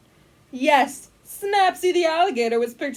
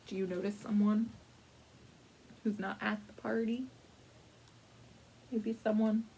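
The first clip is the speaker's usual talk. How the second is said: softer, quicker hint; conspiratorial hush; 4 semitones lower, 11 dB quieter than usual. Acted. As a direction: lean in and hint. Narrator hints at hidden guest.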